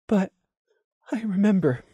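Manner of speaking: lost and confused, barely holding on; blank stutter. Acted. Lemon stuttering blankly through tears to Prey.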